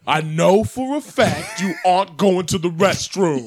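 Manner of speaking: slow, shouted pundit outrage; Stephen A. Smith impression. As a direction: bellow it like a TV sports debater. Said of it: "Stephen A. Smith talks you through a poop".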